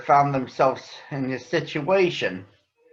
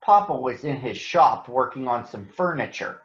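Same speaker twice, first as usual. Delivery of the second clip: gentle storybook narration; reading to children; 2 semitones lower than usual. A narrator's reading. Reading a Berenstain Bears book to schoolchildren, gentle storytelling. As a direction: read it like a picture book for kids.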